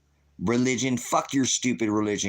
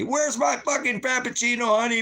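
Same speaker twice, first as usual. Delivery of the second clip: high, shouted whine of a spoiled city dweller; mock shrieking demand for a frappuccino; whiny pampered voice; 14 semitones higher than usual. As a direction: squeal it like a pampered brat demanding coffee. Mocking pampered person in wilderness.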